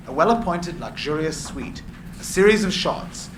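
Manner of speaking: usual speaking voice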